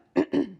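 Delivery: a short nervous throat clear before speaking. Acted. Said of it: "cleared his throat".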